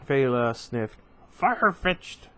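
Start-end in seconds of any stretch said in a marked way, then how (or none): 1.3-2.3 much higher, louder, squeaky cartoon critter; Pokemon-like voice. Bothan Fela dismissing Luke's theory, high squeaky critter voice. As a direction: chirp it like a tiny pocket monster. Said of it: "I really like Pokemon".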